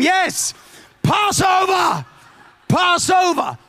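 excited shout. Acted. Angel of death acted joyfully.

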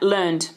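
learned said low and falling, set apart; stress on learned. Shown as a demonstration. Preacher underlining the word for the church, learned stressed low. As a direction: lean down hard on learned. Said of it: "underline that".

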